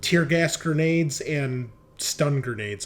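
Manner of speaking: ordinary talk, nothing marked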